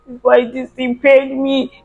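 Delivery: mock sobbing. Retelling her heartbreak to a friend, mock sobbing. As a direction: drag it out like a hurt wail. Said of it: "I was sobbing".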